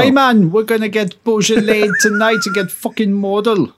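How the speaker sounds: put-on brash Newcastle lad planning a night out, Geordie accent. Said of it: "that was a terrible, uh, Newcastle accent".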